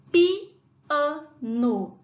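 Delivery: "piano" drawn out slowly, syllable by syllable. Shown as a demonstration. Teacher sounding out "piano" for first graders, very slow. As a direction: stretch "piano" into pi-a-no for the kids. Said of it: "quantas sílabas pra escrever piano".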